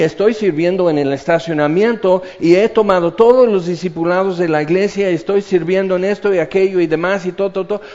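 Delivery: loud, rushing list of credentials; mock self-justification. Servant listing church service to Jesus, mock self-justifying. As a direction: rattle off your merits, pleading your case.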